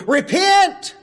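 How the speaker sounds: high, loud cry of Repent!; God screaming. Acted. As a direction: call Repent! like God calling the lost. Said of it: "God screams to that individual".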